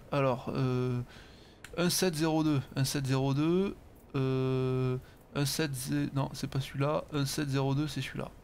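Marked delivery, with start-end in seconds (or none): none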